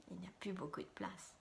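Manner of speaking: quick, very soft aside; muttered aside. Acted. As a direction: mutter it under your breath. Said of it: "deer grumbles aside".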